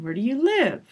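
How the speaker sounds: "Where do you live?" peaking on "live" and dropping; information-question fall. Shown as a demonstration. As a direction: peak then fall. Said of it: "it goes down".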